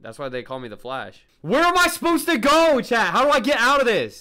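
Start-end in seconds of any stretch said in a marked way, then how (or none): 1.4-4.2 high, loud frantic questions; trapped and panicking; panicked complaint. Real, not acted. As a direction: shout for help while lost. Stuck in maze, chased.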